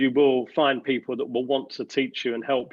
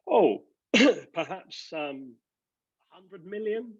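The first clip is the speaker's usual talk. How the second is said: tentative guess; 7 semitones higher, slower than usual. Retold or imitated. Timid guess to the boss.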